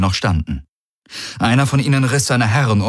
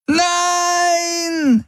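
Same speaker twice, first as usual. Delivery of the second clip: very high, long-held scream. A narrator's reading. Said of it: "schrie Kirai".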